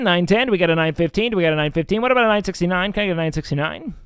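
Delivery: fast loud auction calling; auctioneer. Acted. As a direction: rattle off bids like an auctioneer. Streamer running a charity auction.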